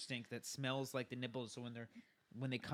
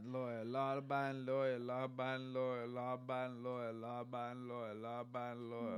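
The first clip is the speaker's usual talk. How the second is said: fast tongue twister. Shown as a demonstration. Friend takes his turn at the tongue twister quickly. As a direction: speed through Law-abiding lawyer over and over. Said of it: "You do it".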